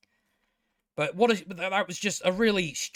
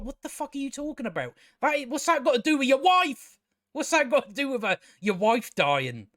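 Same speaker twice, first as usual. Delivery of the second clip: higher, incredulous rant; exasperated disbelief; 7 semitones higher than usual. Retold or imitated. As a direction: throw the question up in exasperation. Repeated reaction, exasperated.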